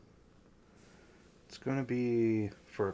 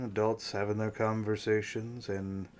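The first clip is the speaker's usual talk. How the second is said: ordinary delivery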